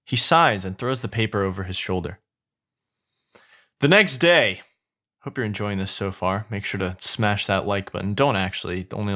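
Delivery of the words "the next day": loud, raised storyteller's heading; announced scene change. A narrator's reading. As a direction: announce a new scene with a flourish. Scene heading, redone plainly after an aside.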